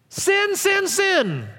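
mocking repetition. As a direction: mimic a preachy nag. Mocking "going on about sin.

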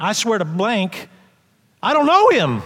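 rising to a loud high shout; desperate denial. Retold or imitated. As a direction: Peter swearing frantically. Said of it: "he probably went".

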